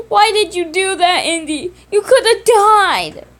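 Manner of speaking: high, loud, crying reproach, slowing at the end; tearful scolding. Acted. Simon scolding Andy for risking his life, crying and high. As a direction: scold him through tears.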